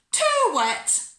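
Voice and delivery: loud, high, drawn-out wail; tantrum complaint. A narrator's reading. Bella objects to her peas at tea, wailing loudly. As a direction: wail both words in protest.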